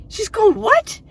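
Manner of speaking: high, loud mock-shocked cry; incredulous daughter. Daughter shocked at her mom, high loud disbelief.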